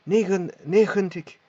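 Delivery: "negentig" with a rasping g from the back of the palate; throaty g. Shown as a demonstration. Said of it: "une prononciation d'un r".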